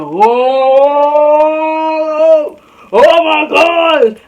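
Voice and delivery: high, loud, stretched-out hooting; mock crowd uproar. Classmates react as student simply stands, mock uproar. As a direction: imitate a crowd going wild, slowly.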